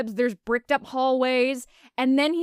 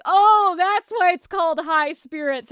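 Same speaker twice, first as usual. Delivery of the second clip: excited realization; 6 semitones higher, 5 dB louder than usual. Retold or imitated. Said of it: "You were like".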